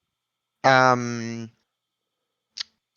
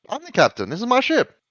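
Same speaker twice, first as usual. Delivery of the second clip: much higher pitched captain asserting rank; captain correcting the navigator, high and indignant; 12 semitones higher than usual. Acted. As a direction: squawk it up high, offended.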